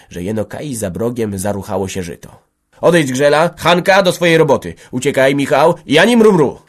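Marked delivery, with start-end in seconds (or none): 2.8-6.6 loud, high-pitched brisk orders; commanding. A narrator's reading. Antek sending everyone off before gendarmes arrive, commanding. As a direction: order each person away firmly. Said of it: "rozkazywał Antek".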